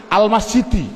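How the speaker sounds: "Al-masjidi" ending in i; jar ending. Shown as a demonstration. Teacher answers his own drill question, jar ending -di. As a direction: sound out "Al-masjidi" with final -di, no n. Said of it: "Jernya bunyinya bagaimana".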